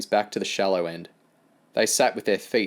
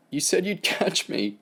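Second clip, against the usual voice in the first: mild tearful; 9 semitones higher than usual. Acted. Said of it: "let out a big sob".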